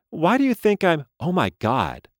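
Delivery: high startled doll voice; a toy caught off guard; talking doll. A narrator's reading.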